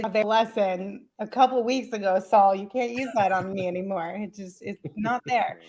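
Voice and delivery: ordinary talk, nothing marked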